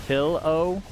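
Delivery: pillow said as "PILL-oh"; careful pillow. Shown as a demonstration. Streamer correcting the teased word, careful "pill-oh" for pillow. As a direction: say pillow with a short i, rising at the end. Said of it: "made fun of for saying".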